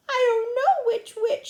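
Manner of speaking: high, loud whine; tearful. Acted. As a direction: complain tearfully to the teacher. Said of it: "said Susan tearfully".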